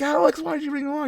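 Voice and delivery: squeaky fast mock outrage at Alex; high startled protest. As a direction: blurt it out high and fast, half-joking. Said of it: "We're like".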